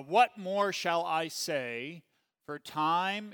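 slow, loud, solemn Bible reading; scripture reading. Acted. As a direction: proclaim each phrase slowly to the class. Reads scripture aloud.

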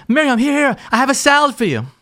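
high, hurried retold husband; imitated breathless eagerness to please; eager offering. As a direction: act out a husband anxiously presenting a peace offering. Husband rushes back with a salad.

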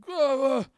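high, loud fake pain cries; mock flop yelp. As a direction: yelp like a flopping player. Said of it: "they're like".

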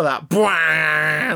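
loud mock trailer horn blast bwah; imitated trailer bwah. Recalling the trailer to a cohost, loud bwah blast. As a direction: belt out a booming brass bwah. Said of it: "like, music thing".

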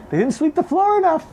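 high, strained mock Trump voice; mocking Trump's repeated floor-sweeping line in a squeaky put-on voice; Trump impression. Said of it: "he's doing his usual thing about forest fires where he says".

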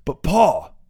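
put-on young man protesting. A narrator's reading.

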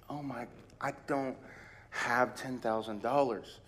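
deflated under the bill; quiet shock; stunned dismay. Retold or imitated. Hearing ten thousand dollar bill.

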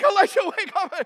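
high, loud frantic wake-up call; freaking out. Acted. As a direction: rouse your master in a panic. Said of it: "He's freaking out".

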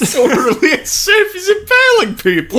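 high, laughing disbelief; incredulous laugh. Real, not acted. Incredulous at the orderly-and-safe claim.

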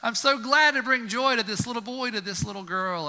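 high, delighted voice; a beaming pair of shoes; happy shoe. Pretend. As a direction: rejoice at bringing a child joy. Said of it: "the shoe's like".

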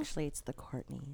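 low, breathy-sounding sexy voice; mock seductive. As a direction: purr it like a seductress. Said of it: "You have a sexy voice on".

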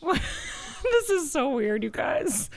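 slow words through real laughter; laughing. Real, not acted. Laughing while telling story.

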